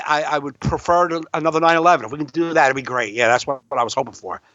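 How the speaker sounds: usual speaking voice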